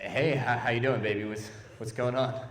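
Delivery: low, soft, hesitant greeting; acted unease, halting and hushed; awkward small talk. Man bad with babies answers one on subway, awkward and low.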